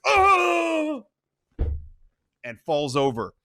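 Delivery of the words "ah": high loud scream. Acted. The Don screaming as he is shot. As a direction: cry out as shot. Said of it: "The Don screams".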